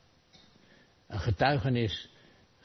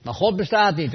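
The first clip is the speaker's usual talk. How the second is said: louder, higher parting shout; called after; 8 semitones higher, 6 dB louder than usual. Retold or imitated. Atheist calls after the hungry man, loud and mocking. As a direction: shout it back over your shoulder. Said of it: "riep hij er nog achteraan".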